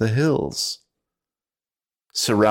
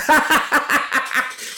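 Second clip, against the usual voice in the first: breathy high cackle. Acted. Driver laughs after joke.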